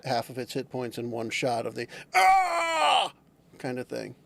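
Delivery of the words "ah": sudden high shriek of pain; pained human scream. Retold or imitated. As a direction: let out a short agonised yell. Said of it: "a, a, a scream of a, um, human enduring".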